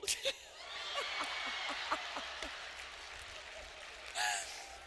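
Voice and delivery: long breathless wheezing laugh; helpless laugh. Real, not acted. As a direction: laugh till you cry. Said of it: "I had tears rolling down".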